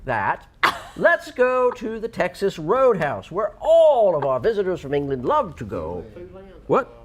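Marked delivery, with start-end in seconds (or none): 1.0-6.0 slow, high put-on British announcer; British accent. Plugging the roadhouse for visitors from England, mock British.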